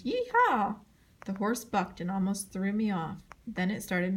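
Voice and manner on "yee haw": high, loud whooping scream; screamed yee-haw. A narrator's reading. Boy digs in heels on the horse, screaming high and loud. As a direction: let rip a wild cowboy whoop.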